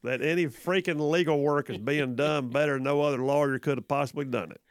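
drawn-out Southern drawl; slow country accent. Pretend. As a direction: read the disclaimer slowly with a country accent. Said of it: "real slow and adding the accent".

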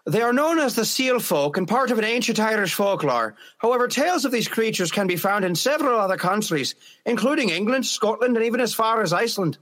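Irish-accented storytelling, pitched a touch up. Shown as a demonstration. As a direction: read it out in an Irish lilt. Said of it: "I'll read it Irish".

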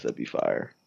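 ordinary talk

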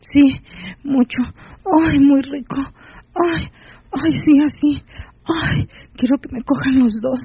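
high, moaning answers with small cries; moaning reply. A narrator's reading. Rosa answering the narrator in high moans.

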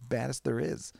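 lightly voiced high hopeless hero; mock hero answer. Heroes admitting defeat in a mock high voice. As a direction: squeak out the grim verdict. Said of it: "They're like".

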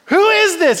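high-pitched mock indignation; offended outburst. Offended David challenging the men around him, high mock outrage. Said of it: "He's offended".

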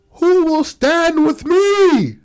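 high, slow, booming call to rally; rally the Senate like a general; booming rousing general speech. Acted. Leader addressing the Senate.

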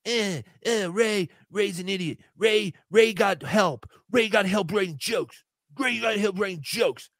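whiny mocked streamer voice; lightly voiced sulky rival; mocking Chad. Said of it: "Chad wants to be like".

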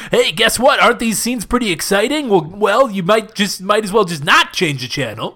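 mock promo, easing down at the end; pleading announcer. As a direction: coax viewers with mock enthusiasm. Pleading mock promo to keep viewers.

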